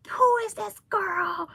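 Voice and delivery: high, slow, outraged demand; a mother furious at the bully; livid mother. Retold or imitated. Mother demanding who hurt her daughter, slow and outraged. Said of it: "She was like".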